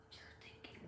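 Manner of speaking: soft unvoiced whisper. Real, not acted. Coach teasing her viewers' thoughts, whispered. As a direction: drop to a whisper, just breath.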